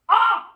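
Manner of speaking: short, high, loud "ah"; frustrated cry. Real, not acted. Speaker forgot her point, frustrated cry. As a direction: let out a sudden yelp of frustration.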